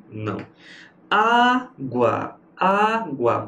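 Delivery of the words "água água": Água split in two, pitched up on "Á"; stress on the first syllable. Shown as a demonstration. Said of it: "paroxítona".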